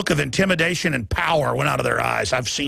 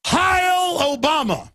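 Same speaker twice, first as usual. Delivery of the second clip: much higher, very slow mock chant; mock salute; 11 semitones higher, much slower than usual. Mocking the press saluting Obama, much higher and drawn out. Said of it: "And they say".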